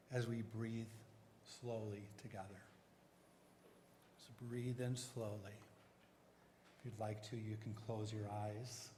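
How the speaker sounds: soft, hushed meditation voice; soft spa whisper. Acted. Guides a breathing meditation.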